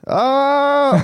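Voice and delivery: high, loud mock scream; a sudden hammed-up shriek. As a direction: shriek out in pretend fright. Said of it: "jag hade skrikit".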